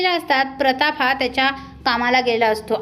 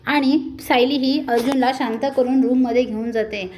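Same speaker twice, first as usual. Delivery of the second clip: ordinary delivery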